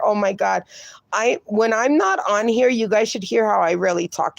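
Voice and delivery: ordinary talk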